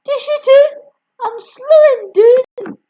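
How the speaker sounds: Grandma yelling for another dose. Acted. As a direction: shriek like a mad old woman. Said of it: "she yelled".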